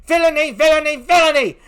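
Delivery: loud, high wail of villainy; anguished outcry. Acted. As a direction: cry it out in horror. Emilia's grief and rage.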